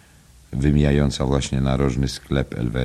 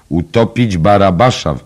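loud, high-pitched outburst; exclaimed shout; 7 semitones higher, 9 dB louder than usual. A narrator's reading. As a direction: cry it out in indignant disbelief. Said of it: "krzyknął pan Dedalus". (głośny okrzyk)

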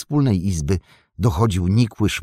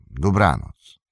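a curt, falling "Dobranoc" in the gatekeeper voice; gatekeeper's curt goodnight; 2 semitones lower, slower than usual. Acted. Gatekeeper dismissing the travellers, curt falling goodnight. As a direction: shut the talk down with a short "Dobranoc". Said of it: "Spytaj o niego Barlimana".

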